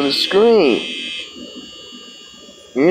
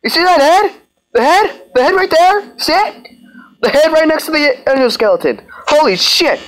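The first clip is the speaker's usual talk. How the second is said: panicked; 9 semitones higher than usual. Real, not acted. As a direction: shout in sudden fright at the head. Sudden scare in horror game.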